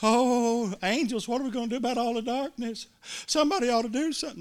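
hammed-up worried plea, rising and rushed; panicked whine. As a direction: pretend to flap about helplessly. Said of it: "You never hear God doing that".

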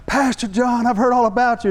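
high-pitched, eager welcome; gushing greeting. Retold or imitated. Big guy running out to meet the pastor, gushing. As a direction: imitate an excited fan rushing up.

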